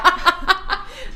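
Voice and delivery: giggle. Real, not acted. Laughing at the joke.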